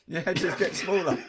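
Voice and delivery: usual speaking voice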